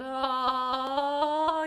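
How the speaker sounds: loud resonant "Oh" on the chest. Shown as a demonstration. Teacher resonating during chest pounding, loud. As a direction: sound out while pounding the chest. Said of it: "making some sound".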